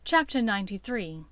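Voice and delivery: usual speaking voice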